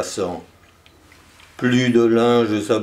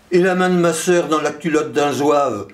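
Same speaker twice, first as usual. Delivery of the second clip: higher-pitched declaimed cry; cried out; 6 semitones higher than usual. A narrator's reading. Last, lettered voice shows off learning, crying out. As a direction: call it out high, without a full shout. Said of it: "criait enfin le dernier".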